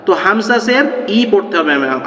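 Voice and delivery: ordinary talk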